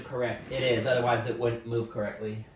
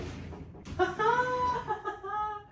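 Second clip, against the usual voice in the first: high sung laughing note. Real, not acted.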